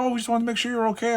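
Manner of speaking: put-on fast, high girly voice; imitating the girl. Girl reassuring him she meant well, high fast. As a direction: rattle it off like a sweet young woman.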